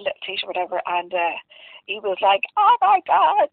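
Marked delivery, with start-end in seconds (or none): 2.6-3.5 high, loud, thrilled squeal; child's excited squeal; delighted gasp. Retold or imitated. Son delighted by t-shirt surprise.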